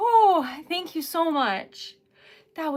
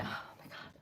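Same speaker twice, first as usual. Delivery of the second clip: stunned breathless whisper; 20 dB quieter, faster than usual. Real, not acted. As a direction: barely breathe it out. Shocked by donation.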